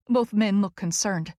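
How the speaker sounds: ordinary delivery, nothing marked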